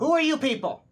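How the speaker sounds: suspicious guard voice. Acted. Guard NPC challenges party.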